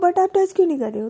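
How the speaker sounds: fast, high, loud whine; impatient demand. Acted. Wife impatiently demanding a kiss, fast loud and high. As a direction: blurt it out impatiently.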